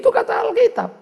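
usual speaking voice

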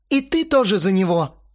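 high, loud hurt accusation; accusing. A narrator's reading. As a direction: round on him indignantly. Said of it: "Астарот резко повернулся к Максу". (обвиняюще, громко и высоко)

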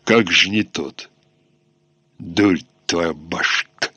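put-on slow, low Tatar accent swallowing word endings. A narrator's reading. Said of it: "Неспешный голос с татарским проглатыванием окончаний пробасил".

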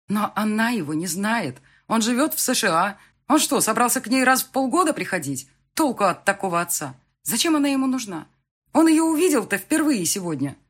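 high, loud, heated arguing; indignant protest. Acted. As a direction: pile up objections in outrage. Daughter argues indignantly with her father. (возмущённо)

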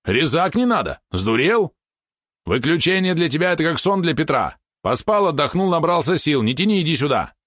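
high-pitched, impatient scolding; indignant. A narrator's reading. Valeron bullies the spider into being switched off, indignant. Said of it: "возмутился Валерон".

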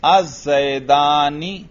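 "الزیدانی" drawn out slowly to a clear final -ni; zer under noon. Shown as a demonstration. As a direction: stretch "الزیدانی", showing the i after n. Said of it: "نون کے نیچے زیر پڑھ رہا ہوں". ("الزیدانی" میں نون کے نیچے زیر، ٹھہر کر)

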